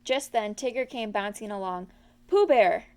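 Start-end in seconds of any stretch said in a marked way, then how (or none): 2.3-2.9 bouncy shout of the name; loud cry. A narrator's reading. Tigger bounding up to Pooh, loud cry. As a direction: call out big and eager. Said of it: "cried Tigger".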